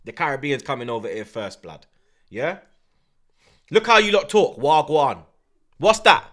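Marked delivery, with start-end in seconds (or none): none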